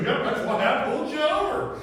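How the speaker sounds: trooper's reply, laughing through it. Retold or imitated. Trooper answering a speeding driver, laughing. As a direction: laugh out the punchline loud and high. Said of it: "He goes".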